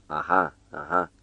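soft, contented aha; a doctor quietly pleased with his diagnosis; very satisfied. A narrator's reading. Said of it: "rosti doctorul foarte satisfăcut".

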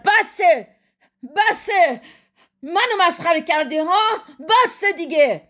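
high, exasperated outburst; impatient shout. A narrator's reading. Husband cutting off his wife, impatient shout. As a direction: cry out as patience snaps.

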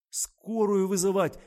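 high, loud, drawn-out cry; panicked, trembling. A narrator's reading. Kira rushes out for an ambulance, panicking. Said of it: "дрожащим голосом пояснила Кира".

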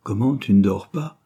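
ordinary delivery

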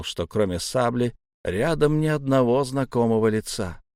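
ordinary delivery, nothing marked

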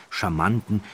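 ordinary delivery, nothing marked

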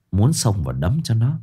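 plain reading voice

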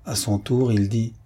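ordinary delivery

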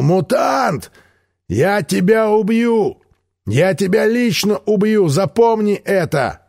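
high, slow roaring threat at the captive; furious yell. Acted. Влас орёт на пленного старика, яростный крик. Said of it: "заорал".

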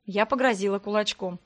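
ordinary delivery, nothing marked

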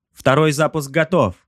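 high-pitched gunnery slave reporting; crew report. A narrator's reading. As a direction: call out readiness in a high voice. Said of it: "доложил раб войны".

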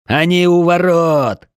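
high, loud, drawn-out alarm; lookout's cry. A narrator's reading. Lookout warns defenders at the gate, high long shout. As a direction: shout the warning down from the wall. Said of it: "Крик наблюдателя со стены".

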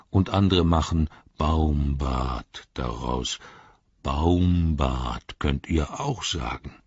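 slow, deep voice of a patient tree giant, sometimes whispered; ancient Ent, slow and low. Acted. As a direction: muse over these strange little folk, taking all the time in the world. Treebeard names himself. (langsame, tiefe Stimme eines uralten Ents)

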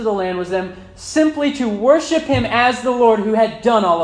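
high, loud pulpit delivery; full-force preaching. Real, not acted.

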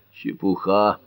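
usual speaking voice

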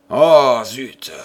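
loud burst on Oh, then dropped; exclaiming. A narrator's reading. Alan brushing off his gloomy friends, exclaiming. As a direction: blurt it out, annoyed. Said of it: "s'exclama Alan".

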